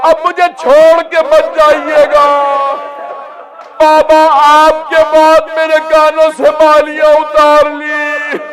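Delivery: mournful sermon chant; full-force masaib recitation. Acted. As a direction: carry the hall into weeping. Final riwayat recited as lament.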